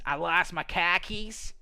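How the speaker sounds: put-on drawn-out Boston accent, rising at the end. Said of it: "you got that Boston accent".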